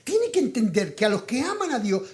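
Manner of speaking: usual speaking voice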